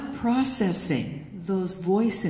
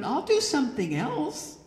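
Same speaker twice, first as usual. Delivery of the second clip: usual speaking voice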